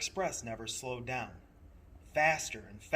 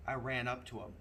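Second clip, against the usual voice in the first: ordinary delivery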